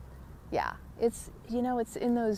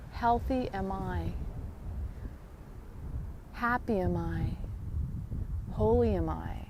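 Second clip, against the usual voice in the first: heartfelt mantra; 4 dB quieter than usual. Shown as a demonstration. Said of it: "the most powerful is when you really feel".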